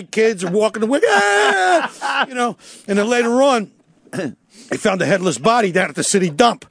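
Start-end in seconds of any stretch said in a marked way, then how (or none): 1.1-2.3 much higher startled shriek; kids' scream. Retold or imitated. Kids recoiling from the head, high scream.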